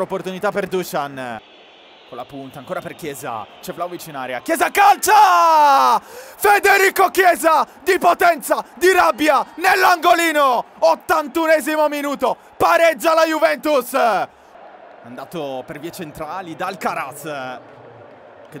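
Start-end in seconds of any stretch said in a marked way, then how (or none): 4.4-14.0 much higher and louder, full-throat goal call; goal scream. Real, not acted. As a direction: roar the equaliser out at full force.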